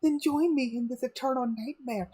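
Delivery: dramatic villain line. Pretend. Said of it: "watch me in my great, um, acting".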